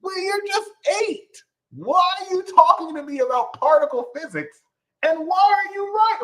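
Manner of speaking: high, incredulous mock parent; stunned parent. Parent baffled by a toddler, high and incredulous. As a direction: squeak out disbelief at the genius kid.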